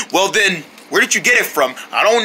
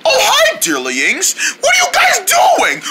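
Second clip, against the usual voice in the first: high squeaky cartoon germ; 14 semitones higher, slower than usual. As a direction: play a tiny squeaky germ.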